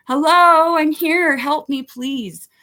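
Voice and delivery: high, loud, slow calling out for attention; drawn-out plea, waving for someone to notice; pleading call for help. Retold or imitated. Begging victim services for help, slow pleading call. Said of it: "I said".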